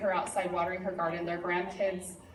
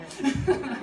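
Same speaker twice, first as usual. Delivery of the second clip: loud high laugh; a real burst of laughter, higher and louder; laughing out loud at herself; 7 semitones higher, 6 dB louder than usual. Real, not acted. Laughs at own story.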